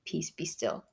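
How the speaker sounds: low, hushed and calming; calm, soft command. Retold or imitated. Jesus calming the storm, soft low command. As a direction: speak it softly like soothing a storm. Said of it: "calmly say".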